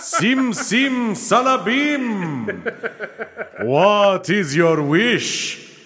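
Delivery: slow, booming genie voice; grand mystical genie, drawn out. Pretend. Improvised genie scene.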